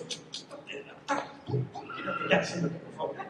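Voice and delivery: whispered mock commands while bustling about; breathy play-acted fussing; mimed hurried bustle. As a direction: act out shooing someone off, all in a whisper. Acts excited Abraham shooing Isaac, muffled.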